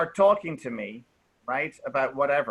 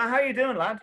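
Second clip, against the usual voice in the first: higher-pitched, chummy greeting; really friendly; 7 semitones higher than usual. Shown as a demonstration. Teacher greets Marco as a friend would, really friendly. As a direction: greet a pal with an upward lilt. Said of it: "in a really friendly way".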